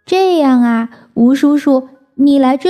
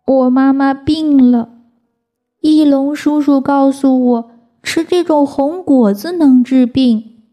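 sad reply; 5 semitones higher than usual. Acted. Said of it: "抽抽搭搭悲伤地回答".

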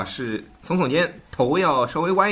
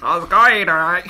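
raised, louder Aussie-style question; imitating Australian; 5 semitones higher, 5 dB louder than usual. Co-host copying the teacher's greeting, imitated Australian accent. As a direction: mimic an Australian asking after a mate.

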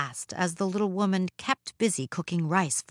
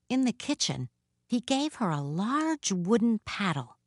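usual speaking voice

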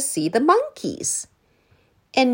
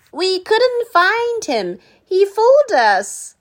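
put-on high, louder child voice; amazed children; 12 semitones higher, 6 dB louder than usual. A narrator's reading. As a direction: marvel at the trick. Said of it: "said the children".

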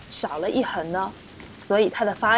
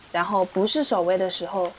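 normal talking voice

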